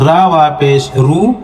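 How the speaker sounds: usual speaking voice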